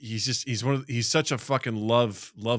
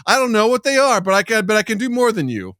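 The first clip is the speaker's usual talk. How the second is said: higher, louder pushy dog voice; intense dog; 9 semitones higher, 8 dB louder than usual. Retold or imitated. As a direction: crowd the other dog, way too eager. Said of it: "like, so intense".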